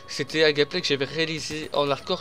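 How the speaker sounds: usual speaking voice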